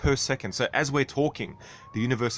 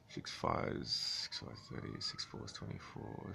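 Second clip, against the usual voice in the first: whispered arithmetic; 10 semitones lower, 16 dB quieter than usual. Real, not acted. Calculating the Hubble constant in miles, whispered. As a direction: whisper the sums to yourself. Working out math under breath.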